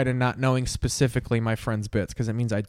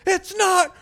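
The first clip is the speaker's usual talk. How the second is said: frustrated outburst; 4 dB louder than usual. Retold or imitated. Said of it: "Crocious just goes".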